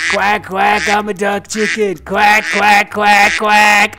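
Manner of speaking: playful sung quack quack; a kid pretending to be a duck chicken, quacking.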